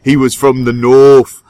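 loud mock Northern English accent. Comic introducing a friend from England, loud northern English accent. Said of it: "he was also from England".

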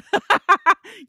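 high, loud self-conscious laugh; teary laugh breaking the tension; embarrassed laugh. Real, not acted. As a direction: laugh it off while collecting yourself. Laughs at getting herself together.

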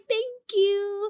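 high, forced-polite customer-service voice; super nice. Retold or imitated. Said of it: "you kinda have to be super nice".

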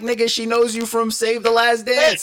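high, loud laughing disbelief; incredulous retort. Retold or imitated. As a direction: voice shoots way up in mocking amazement. Acted incredulous retort in a story.

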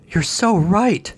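high, slow, starry-eyed gushing. A narrator's reading. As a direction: swoon in agreement. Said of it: "Constance gushed".

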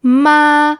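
ma held high and level; "ma" long, high and even; high flat tone. Shown as a demonstration. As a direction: sustain "ma" on one high pitch. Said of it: "high and flat tone".

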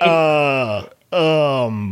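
drawn-out, doubtful hesitation; imitated hesitant woman. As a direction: act out her stalling for words. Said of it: "And she's like".

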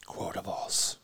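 hushed, whispered title echo; soft playful whisper. Pretend. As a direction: whisper it back like a tagline. Echoes the game's title.

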